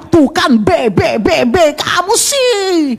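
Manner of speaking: rapid repeats into a high, drawn-out whine; istri menyalahkan. Acted. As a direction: gloat and blame as the wife. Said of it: "jangan bilang". (istri mengomel penuh kemenangan)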